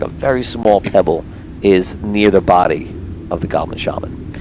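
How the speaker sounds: ordinary talk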